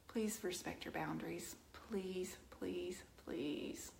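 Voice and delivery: whispered please, please, please; pleading whisper. Real, not acted. Whispering a plea to her livestream viewers. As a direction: beg them in a hushed whisper.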